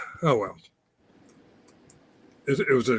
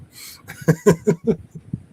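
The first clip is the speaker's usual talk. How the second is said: laugh; 5 semitones higher, 4 dB louder than usual. Real, not acted. Laughing at own joke.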